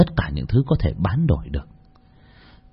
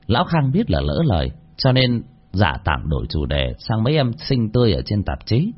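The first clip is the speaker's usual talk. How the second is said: ordinary delivery, nothing marked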